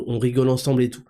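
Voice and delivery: ordinary talk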